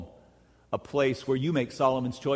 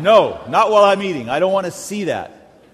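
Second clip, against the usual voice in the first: loud, higher mock outcry; mock protest; 6 semitones higher, 11 dB louder than usual. Husband refusing the digestive-system placemat, loud mock protest. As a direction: playfully shout it down.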